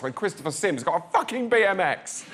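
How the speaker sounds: normal talking voice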